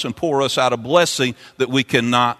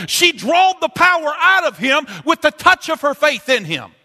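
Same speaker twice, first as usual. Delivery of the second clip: preaching at full force; 10 semitones higher than usual. Acted. Preacher stirring the congregation with the healing woman, full force preaching. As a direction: drive the story home to the congregation.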